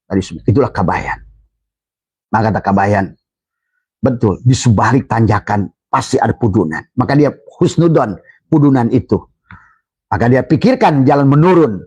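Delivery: ordinary delivery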